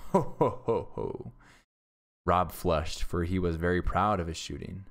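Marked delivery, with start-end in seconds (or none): 0.1-1.3 loud jeering ho ho ho, falling away at the end; big mocking forester laugh. A narrator's reading. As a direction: laugh him to scorn. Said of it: "They laughed again loudly at this".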